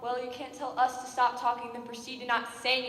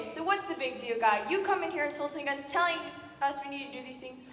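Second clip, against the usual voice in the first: ordinary talk, nothing marked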